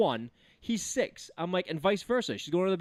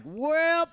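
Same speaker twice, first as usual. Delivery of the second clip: mock whoop; 10 semitones higher, 4 dB louder than usual. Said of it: "I was like".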